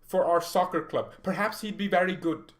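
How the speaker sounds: high, loud hammed-up German accent; a goofy German-accented coach; mock German Nagelsmann. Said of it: "That's how Julian Nagelsmann sounds".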